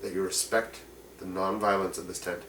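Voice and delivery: a soft, low plea from an orc elder to strangers; orc man pleading. Acted. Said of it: "he turns to you and says".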